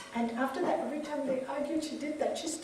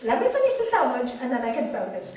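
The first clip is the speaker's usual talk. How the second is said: laughing hurried reply; 7 dB louder, faster than usual. Retold or imitated. Mom laughing, brushing kids off.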